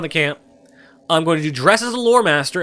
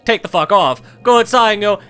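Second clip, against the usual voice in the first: ordinary talk, nothing marked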